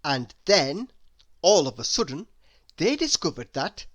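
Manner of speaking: ordinary delivery, nothing marked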